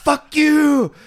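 high loud shouted curse; mock angry yell. Sprayed man curses the cops, high loud yell.

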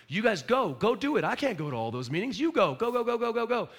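a coach hurrying the team out; urgent send-off. Retold or imitated. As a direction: push them out the door. Pastor voicing sending people out.

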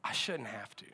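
low, hushed, serious wife. Retold or imitated. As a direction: drop right down, quiet and grave. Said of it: "she was so serious".

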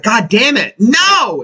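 high mock-exasperated cry, climbing on the last word; hammed-up dismay; anguished protest. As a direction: act out being forced on against your will. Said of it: "He's forced".

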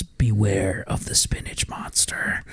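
whispered, slow, hushed warning; mock ominous whisper.

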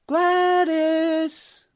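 high, loud call of a mother's voice; mother calling her daughter from below; calling out from downstairs. A narrator's reading. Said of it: "a woman's voice called from somewhere downstairs".

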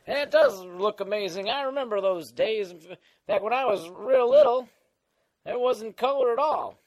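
put-on creaky old-timer voice; Old Man Dan. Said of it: "uh, Old Man Dan?".

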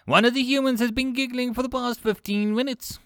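high-pitched, fretful creature voice; agitated small alien. Acted. Five Clicks reports a giggling human to Kolch, agitated.

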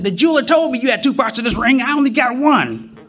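wife's voice. Retold or imitated. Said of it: "She said".

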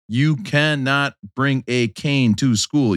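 slow, word-by-word telling-off; stern parent. Acted. Dad voices the parents he wishes had stepped in, stern parent. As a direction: lay down the rule, each word separate. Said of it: "Should my parents have stepped in and be like".

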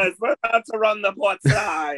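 high, hammy pirate captain. Pretend. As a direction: roar orders across the deck. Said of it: "I just wanted to do a pirate voice".

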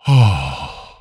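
heavy spent sighs of relief; winded "oh" on each exhale; acted catching breath. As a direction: puff out exhausted, acted sighs. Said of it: "I had to catch my fucking breath".

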